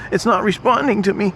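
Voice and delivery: squeaky high panic; high mock panic. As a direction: repeat it up high in mock alarm. It's not responding to me.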